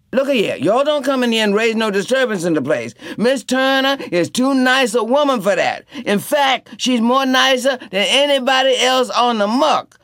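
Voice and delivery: high, loud stern warning; Tea Cake scolding. A narrator's reading. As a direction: lay down the law to drunks.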